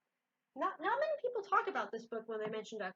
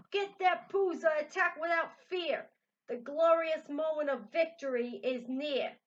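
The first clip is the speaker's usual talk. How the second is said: yelling; 7 semitones higher, 8 dB louder than usual. Acted. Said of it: "And yelled".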